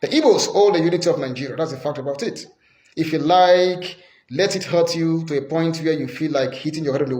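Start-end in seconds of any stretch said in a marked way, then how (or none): none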